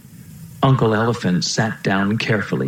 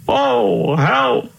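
high, wailing call for help; high-pitched storybook distress; cry for help; 12 semitones higher than usual. A narrator's reading. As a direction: cry out like a lost old king. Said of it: "cried the king".

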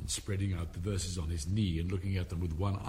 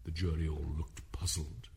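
ordinary delivery, nothing marked

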